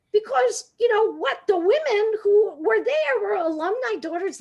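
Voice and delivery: usual speaking voice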